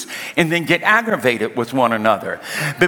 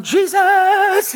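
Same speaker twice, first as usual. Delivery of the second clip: mock panicked cry; 3 dB louder than usual. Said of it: "she was going".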